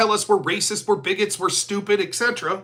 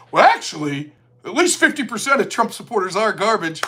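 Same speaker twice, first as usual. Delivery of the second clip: mocking the co-host; 3 semitones lower than usual. Mocking the co-host's garbage remark, smug low voice. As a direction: lower smug know-it-all voice, mocking. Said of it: "comes onto his show and says".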